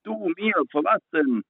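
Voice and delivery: father explodes at his son, indignant high voice; father exploding. Retold or imitated. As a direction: fling it back in outrage. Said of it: "der ist explodiert".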